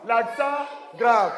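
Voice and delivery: slow, deliberate teacher model; slow model phrase. Shown as a demonstration. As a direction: say it slowly for the class to repeat. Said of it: "Nous allons maintenant avec l'accent grave".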